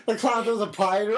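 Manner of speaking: ordinary talk, nothing marked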